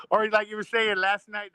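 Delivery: ordinary talk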